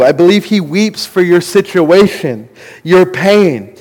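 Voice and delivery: ordinary delivery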